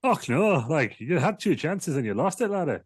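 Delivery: high, slow mock-Irish telling-off; Irish brogue. Sheamus tells Drew he had two chances, in a high mock-Irish voice. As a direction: scold him like a cross Irish uncle. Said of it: "And Sheamus is like".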